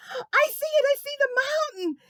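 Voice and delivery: high, loud, thrilled shout; excited kid. Acted. Preston shouting when he first sees the mountain. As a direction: spot it and yell with joy.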